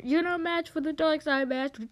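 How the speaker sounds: high, keyed-up boast; hammed-up challenge to a master; villain taunt. Acted duel taunt.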